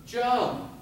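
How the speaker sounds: high-pitched urging call; mock calling out. Mother to her hesitant child in the alleyway, calling out high. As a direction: throw the word out high to a child.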